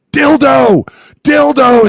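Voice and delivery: very high, loud bellowed call; shouted code word. Retold or imitated. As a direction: holler the silly word at full volume. Player shouting silly code word.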